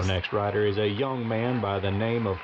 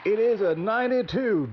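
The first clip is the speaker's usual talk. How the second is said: excited score call; 11 semitones higher than usual. Acted. Announcer reveals winning score.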